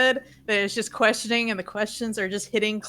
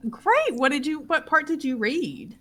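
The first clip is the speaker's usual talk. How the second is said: high, loud burst on "great"; eager adult encouraging a child; enthusiastic encouragement; 6 semitones higher, 4 dB louder than usual. Acted. [name] praises child's reading.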